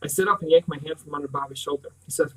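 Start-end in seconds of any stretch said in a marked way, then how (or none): none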